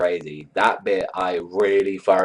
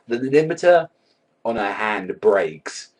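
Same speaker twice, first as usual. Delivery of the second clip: ordinary talk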